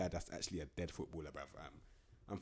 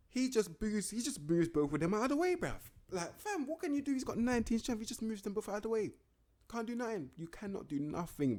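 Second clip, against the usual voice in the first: much higher and louder, disbelieving; excited; 13 semitones higher, 4 dB louder than usual. Real, not acted. Describing a striker shrugging defenders off, high excited voice. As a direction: hype up the unstoppable striker.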